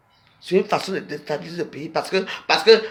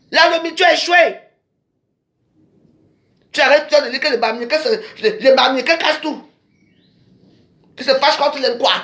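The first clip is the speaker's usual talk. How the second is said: raised, higher, accusing tirade; heated rant; 5 semitones higher, 3 dB louder than usual. Real, not acted. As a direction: hammer the accusations home, louder. (diatribe enflammée contre l'adversaire)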